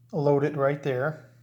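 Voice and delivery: ordinary delivery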